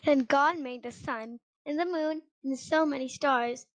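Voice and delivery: usual speaking voice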